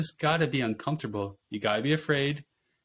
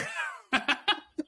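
high laugh. Real, not acted. Laughs at own story.

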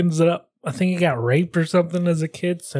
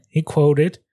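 usual speaking voice

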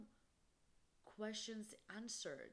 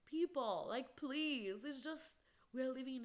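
louder, higher, exasperated appeal; exasperated plea; 6 semitones higher, 8 dB louder than usual. Real, not acted. Podcaster pleading with ignorant people, exasperated. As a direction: plead loudly, fed up.

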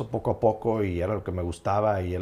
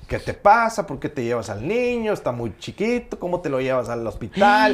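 higher, louder mock scolding as mom; hammed-up outraged mother, higher and louder; 7 semitones higher, 4 dB louder than usual. Mother scolding father for taking the boy, mock outraged and higher.

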